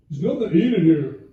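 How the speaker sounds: put-on loud, whiny teen complaint; a lightly voiced sulky kid in the galley; impression of kid. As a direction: son whining about no food. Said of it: "I'm gonna do an impression of my kid".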